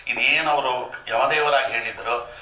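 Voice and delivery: usual speaking voice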